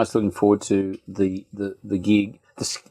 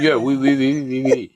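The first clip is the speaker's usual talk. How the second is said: pursed-lip French. Shown as a demonstration. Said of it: "pursing your lips makes it sound more French".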